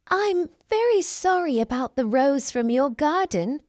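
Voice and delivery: plain reading voice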